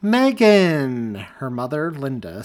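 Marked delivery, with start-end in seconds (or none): 0.0-1.1 delighted, much higher and louder; thrilled. A narrator's reading. Grandmother thrilled to hear her daughter on the phone, delighted cry. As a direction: greet your daughter with joy. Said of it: "sounded thrilled to hear her voice".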